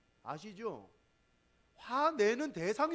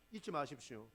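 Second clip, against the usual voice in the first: normal talking voice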